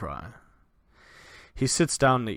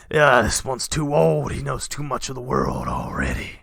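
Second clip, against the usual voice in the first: bandit king; 5 dB louder than usual. Acted. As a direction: sneer and reject the boy. Bandit king repeats line.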